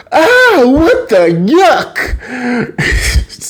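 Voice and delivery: drawn-out, high-pitched shock; mock shocked shriek. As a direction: act out a horrified mom's outburst. Mom walks in, shocked.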